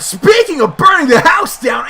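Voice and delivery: thrilled, pushed-up voice; excited commentary. Real, not acted. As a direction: sound the alarm with rising excitement. Reacting to table set alight.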